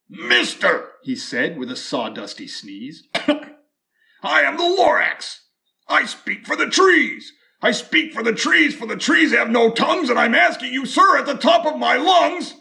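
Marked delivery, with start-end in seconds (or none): 0.1-0.9 high, sharp little creature calling out; sharpish and bossy. Acted. Lorax calling out to the Once-ler, sharp and high. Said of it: "sharpish and bossy". 3.1-12.6 high, loud, bossy creature voice; sharpish, bossy Lorax. Acted. Lorax scolding the Once-ler for the trees, high and bossy. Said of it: "sharpish and bossy".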